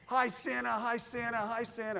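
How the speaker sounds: high, excited crowd calls, repeated; crowd screaming to Santa. Retold or imitated. Parade crowd greeting Santa, high excited calls. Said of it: "everyone is screaming".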